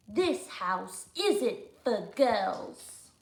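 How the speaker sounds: very slow, drawn-out rule; slow, snooty decree. Acted. George telling the girls to stay out, very slow. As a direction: lay down the rule word by word.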